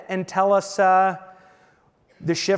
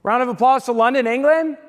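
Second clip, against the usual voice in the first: higher, louder call to the room; 7 semitones higher, 7 dB louder than usual. Real, not acted. Host to the audience after Joe's story, loud rousing call. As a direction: rouse the crowd to clap.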